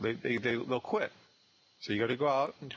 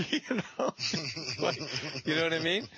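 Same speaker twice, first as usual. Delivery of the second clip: laughing; 6 semitones higher than usual. Real, not acted. Laughs at own joke.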